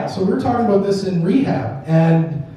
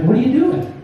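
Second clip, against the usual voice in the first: higher, rising questioning tone; puzzled child's question; 7 semitones higher than usual. Retold or imitated. Daughter catches dad mid-accident, puzzled higher question. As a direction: ask it with the pitch climbing at the end.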